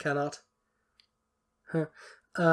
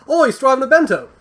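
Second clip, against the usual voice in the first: higher, louder, hammed-up Australian accent; bad Australian accent; 8 semitones higher, 8 dB louder than usual. Joking that bento is Australian slang, bad Australian accent. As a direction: put on a poor mock Australian accent. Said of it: "that was not a good accent".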